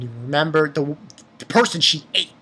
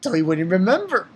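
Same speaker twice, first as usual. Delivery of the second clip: usual speaking voice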